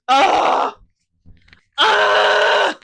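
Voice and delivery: loud, fangirl moan of delight; swooning groan.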